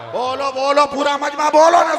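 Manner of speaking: loud, high rallying cry; calling out to crowd. Real, not acted. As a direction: rouse the whole gathering. Urging crowd to respond. (मजमे को ज़ोर से पुकारना)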